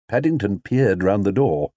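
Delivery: usual speaking voice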